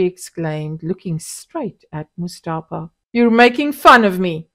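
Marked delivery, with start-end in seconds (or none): 3.1-4.5 louder, accusing tone; affronted. A narrator's reading. Husband accusing his mocking wife, louder. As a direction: call out her mockery.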